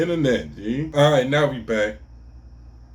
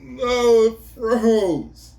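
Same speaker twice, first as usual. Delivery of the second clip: louder, higher no! at the frozen stream; frustrated shout; 11 semitones higher, 8 dB louder, much slower than usual. Real, not acted. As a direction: yell at the screen as it freezes. Stream freezes mid-story.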